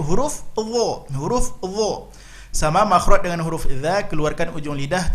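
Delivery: ordinary delivery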